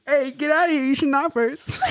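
high scolding at an intruder; indignant. Pretend. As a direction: shoo someone out, offended. Telling intruder to leave.

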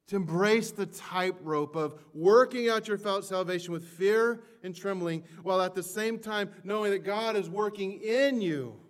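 impassioned sermon peak; preaching at full force. Acted. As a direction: preach it out, swelling with each phrase.

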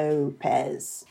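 "Lopez" with a long Australian O. Shown as a demonstration. Host showing where the accent clicks, Australian O in "Lopez". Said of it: "it really starts to get there when you get the".

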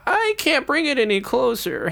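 high, timid monk's voice; reluctant helper voice, high and loud; nervous Brother Michael. Acted.

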